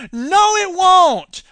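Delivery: loud, high, slow no; emphatic shout. Real, not acted. Ranting host shouting down the idea. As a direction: shout it down, drawn out. Ranting against rational markets.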